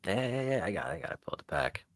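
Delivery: usual speaking voice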